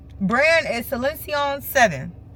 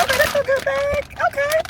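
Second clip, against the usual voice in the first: much higher, squealing with delight. Real, not acted. Opening the Too Good To Go bag, squealing with delight. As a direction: let it jump up into a thrilled squeak.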